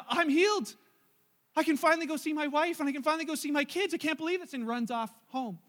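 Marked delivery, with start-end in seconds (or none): 0.1-4.5 much higher, louder burst of joy; the healed man can't believe his luck; overjoyed disbelief. Retold or imitated. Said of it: "one guy realizes".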